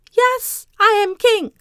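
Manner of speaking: haughty crowned wife declaring herself king; proud queen. Acted. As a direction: announce it from the throne.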